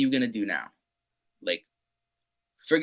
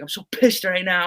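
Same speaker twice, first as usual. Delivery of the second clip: mock frustration; 7 semitones higher than usual. Quoting himself to coach.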